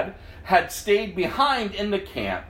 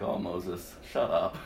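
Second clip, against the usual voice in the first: mock exasperation; 12 semitones lower, 7 dB quieter than usual. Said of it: "I'm like".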